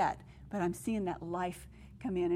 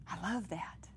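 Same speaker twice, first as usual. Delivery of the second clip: awed whisper; 7 dB quieter than usual. Real, not acted. Awed aside after the verse.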